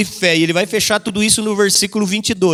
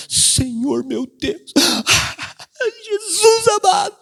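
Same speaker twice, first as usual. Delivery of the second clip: hammed-up tearful prayer; mock weeping prayer; 11 semitones higher, slower than usual. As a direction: fake sobbing to move God.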